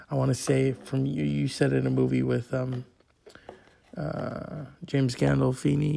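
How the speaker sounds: ordinary delivery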